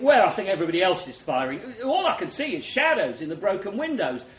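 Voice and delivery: usual speaking voice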